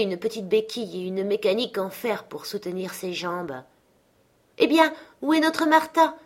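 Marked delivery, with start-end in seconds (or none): 4.6-6.1 higher, louder cheerful call; cheerful father calling out. A narrator's reading. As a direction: call out for her as the happy father. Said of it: "s'écria Bob Cratchit". (père joyeux qui s'écrie, plus aigu et fort)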